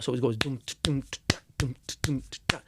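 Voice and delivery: soft vocal beat "doom, t-, ta"; beatboxed dancehall rhythm. Shown as a demonstration. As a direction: mouth the dancehall drum pattern quietly. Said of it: "that kind of rhythm".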